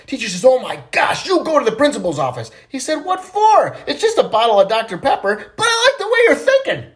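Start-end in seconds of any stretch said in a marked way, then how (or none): 0.4-2.5 high, loud acted outrage; scandalised teacher. Teacher ordering Johnny to the principal's office, scandalised. 3.1-6.9 very high, loud protest; indignant schoolboy. Acted. Johnny protesting to the teacher, loudly indignant. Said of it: "little Johnny's all upset".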